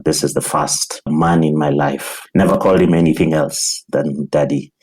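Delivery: normal talking voice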